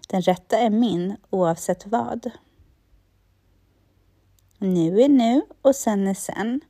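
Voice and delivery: steady self-help affirmation reading, leaving space to repeat; guided affirmations. Acted. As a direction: calmly lead the listener through each affirmation. Leads spoken affirmations, say after me.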